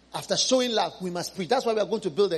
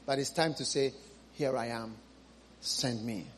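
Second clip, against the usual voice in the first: hushed plea; 8 semitones lower, 9 dB quieter than usual. Real, not acted. Preacher drops to quiet plea.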